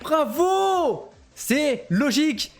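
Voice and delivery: voix très haute, mots étirés et martelés; annonce triomphale. Real, not acted. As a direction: proclamer les vainqueurs en plein enthousiasme. Annonce les vainqueurs de la course. (cri de victoire du commentateur)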